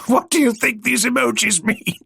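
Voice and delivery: high squeaky question; strangled high voice. Acted. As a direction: squeak the question, fighting back laughter. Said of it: "Sparks squeaked".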